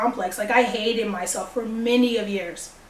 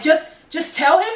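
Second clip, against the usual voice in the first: retold disbelief, higher with a rising end; incredulous echo; 5 semitones higher than usual. Scared teen answering the girlfriend's easy advice, imitated incredulity. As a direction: throw the advice back as a stunned question.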